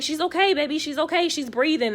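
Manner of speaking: calm her down like a mother; lightly voiced reassuring mother; soothing mom. Mom soothing panicked daughter.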